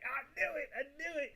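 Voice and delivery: gloating at a comment confirming his guess, high and loud; triumphant. Real, not acted. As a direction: crow it out in victory. Triumphant reaction to Tony's comment.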